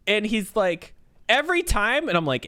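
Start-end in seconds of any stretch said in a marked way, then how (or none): 1.3-2.1 high, loud disbelieving rise on "Every time"; horrified question. Retold or imitated. Coworker learning the routine, high and appalled. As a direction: push "Every time" way up in horror. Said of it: "shock, confusion, and horror".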